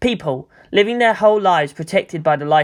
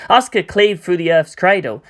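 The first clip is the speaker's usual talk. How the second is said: ordinary delivery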